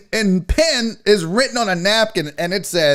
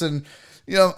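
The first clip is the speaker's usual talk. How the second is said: ordinary talk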